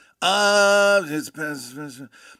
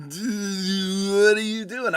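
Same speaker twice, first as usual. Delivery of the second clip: higher-pitched, stretched Californian accent; 5 semitones higher, slower than usual. Shown as a demonstration. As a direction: put on a hammed-up Californian accent. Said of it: "my best Californian accent that I have".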